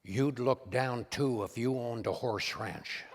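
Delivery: low, slow Reagan delivery with a chuckle; wry presidential comeback; Ronald Reagan's punchline. Retold or imitated. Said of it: "He said".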